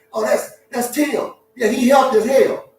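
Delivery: high, slow then loud easygoing zebra; zebra vouching. Pretend. Frank the zebra vouches for Tim to the lion, high and loud. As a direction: vouch for your buddy.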